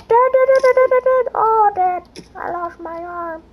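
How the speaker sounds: high, loud, rapid calls for dad; panicked child calling. Acted. Child character crying out to his dad after losing an arm. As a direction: shriek for your father like a little kid.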